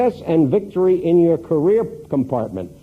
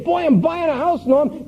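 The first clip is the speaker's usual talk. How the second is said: much higher, eager new homeowner burst; very excited. Retold or imitated. Client telling Norm about his new house, excited. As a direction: gush like you just got the keys. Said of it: "Very excited".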